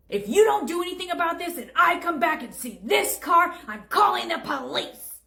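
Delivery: loud, high indignant threat; angry threat. Acted. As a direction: threaten loudly, slowing the last words. Customer threatens cashier.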